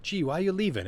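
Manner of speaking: high, loud, pleading question; upset Corky. Acted. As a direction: protest a friend's leaving in a high little voice. Corky pleads with departing friend.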